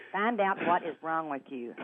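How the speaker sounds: ordinary delivery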